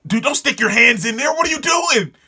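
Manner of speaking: high, urgent shout of warning; exasperated, high-pitched outburst; alarmed yelling. Real, not acted. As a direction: yell at a fool to stop, pitch climbing. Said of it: "I'm sorry if I'm yelling".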